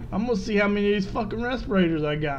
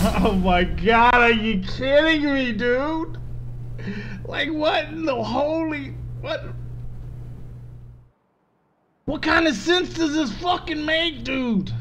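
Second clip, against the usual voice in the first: exasperated rant; 6 semitones higher than usual. Real, not acted. Loot crate disappointment rant.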